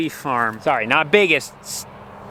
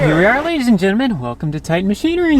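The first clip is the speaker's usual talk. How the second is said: announcer voice; faster than usual. Pretend. Mock announcer arrival.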